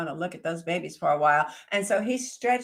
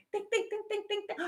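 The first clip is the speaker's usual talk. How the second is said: high, quiet repeated think; thinking hard; 14 semitones higher, 5 dB quieter, faster than usual. Acted. Hare puzzling it out, high and soft. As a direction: hare muttering to herself to think.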